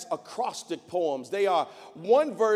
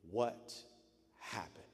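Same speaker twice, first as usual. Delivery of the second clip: hushed, much softer and lower, aching; grieving question; 9 semitones lower, 15 dB quieter than usual. Acted. Jeremiah looking over the ruined city, hushed grief. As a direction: let the question barely escape, heartbroken.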